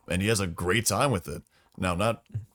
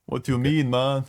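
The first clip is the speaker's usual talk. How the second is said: loud, high mock Haitian accent; hammed-up Haitian accent; 6 semitones higher, 4 dB louder than usual. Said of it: "The Salamanders are a bunch of Haitians".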